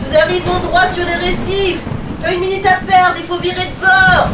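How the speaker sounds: high, loud alarmed shouting; captain panicking as the reefs come close; panicked captain. Acted. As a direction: yell the danger in a panic. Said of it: "affolé".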